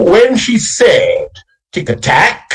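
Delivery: high, slow chant with weight on SAID and TACK; singsong "When she said, tick-a-tack", last beat of each three stretched; stress on third syllable. Shown as a demonstration. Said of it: "hear the syllables stressing on the third".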